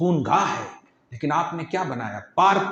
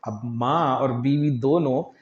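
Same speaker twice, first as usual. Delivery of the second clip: ordinary talk, nothing marked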